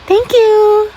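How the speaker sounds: put-on girly voice.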